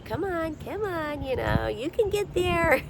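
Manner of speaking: high, coaxing guide voice; encouraging spirit guide voice. Pretend. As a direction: cheer them on like a spirit guide. Voicing guides dropping little hints.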